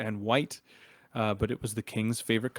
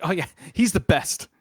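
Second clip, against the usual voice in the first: put-on high, loud gush of boyish admiration; over-excited, pitched-up praise; almost too excited; 7 semitones higher, 6 dB louder than usual. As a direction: blurt it out like an overeager kid. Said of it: "almost too excitedly".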